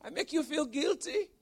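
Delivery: high incredulous squeak; mock disbelief. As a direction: echo her words in high disbelief. Mock disbelief at wife's words.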